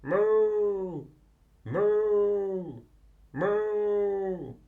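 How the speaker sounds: loud drawn-out mooing like a cow. Acted. A cow lowing in the night, loud moos. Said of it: "hears a noise".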